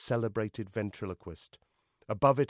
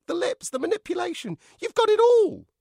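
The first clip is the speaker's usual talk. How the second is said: high-pitched dummy gushing, louder to the end; excited dummy; 10 dB louder than usual. Acted. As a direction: gush in a high dummy voice, building. Dummy character praises.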